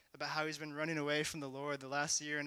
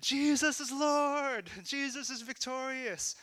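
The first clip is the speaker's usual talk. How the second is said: high, drawn-out shout of proclamation; imitated group shout; 10 semitones higher, 6 dB louder, slower than usual. Students declaring in the middle of campus, re-enacted full-lunged shout. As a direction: declare it at the top of your lungs. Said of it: "at top of our lungs in the middle of campus".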